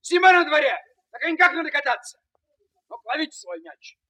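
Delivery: high, loud telling-off; angry scolding. Acted. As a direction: scold the rascals loudly. Scolding boys over broken window.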